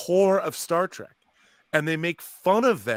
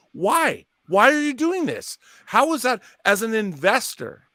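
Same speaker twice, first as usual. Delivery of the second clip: indignant outburst; 7 semitones higher, 3 dB louder than usual. Real, not acted. Ranting at Paramount.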